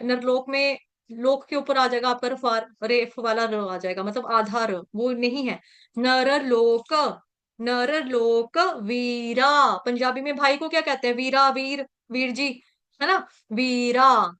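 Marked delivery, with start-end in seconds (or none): 6.0-9.8 नर लोक said slowly with a clear break, no r before लोक; नर और लोक अलग अलग, धीरे; split into two words. Shown as a demonstration. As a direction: say नर, pause, then लोक. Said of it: "इसको इस तरह से पढ़ेंगे".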